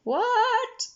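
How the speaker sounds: high, loud shout over the noise; shouted what. Acted. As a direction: yell it over the engine. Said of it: "shouted Greasy Hands".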